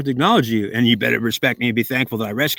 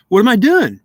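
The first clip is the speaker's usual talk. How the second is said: much higher and louder, demanding what he did wrong; indignant shout; 10 semitones higher, 7 dB louder than usual. Acted. Man protesting to the devil, startled loud demand. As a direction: fire back the question, stunned and indignant.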